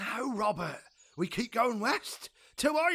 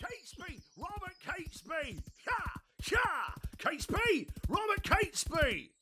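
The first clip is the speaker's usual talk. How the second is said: calling out; 10 semitones higher than usual. Acted. Rookwood calls out riding up.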